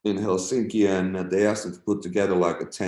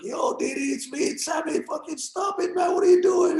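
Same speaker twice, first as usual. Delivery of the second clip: very high, strained retold protest; imitating his own panicked shout; alarmed pleading. Retold plea to a yelling friend.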